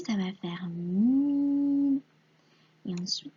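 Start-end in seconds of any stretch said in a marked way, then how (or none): 0.7-2.0 "mi" drawn out, m and i both held; blended syllable. Shown as a demonstration. Teacher joining M and I for a pupil, drawn-out blend. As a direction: stretch "mi" slowly into one long syllable. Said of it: "M et I".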